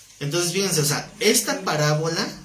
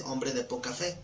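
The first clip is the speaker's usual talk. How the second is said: much softer, lower reproach; soft rebuke; 3 semitones lower, 9 dB quieter than usual. Retold or imitated. The Lord reproaching Peter softly as he pulls him out. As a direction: hush it like a sad scolding.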